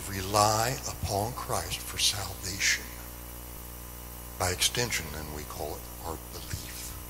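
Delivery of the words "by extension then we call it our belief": ordinary talk, nothing marked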